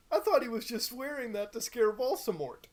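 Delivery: high, loud outraged complaint; angry shout. Acted. Reader still shouting at Dumbledore's makeover. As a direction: keep the indignant yell going. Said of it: "I shouted angrily".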